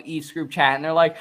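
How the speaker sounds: ordinary talk, nothing marked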